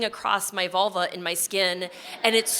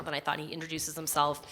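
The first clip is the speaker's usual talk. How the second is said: usual speaking voice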